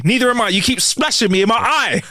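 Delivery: hammed-up angry protest; angry reply. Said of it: "He replied angrily".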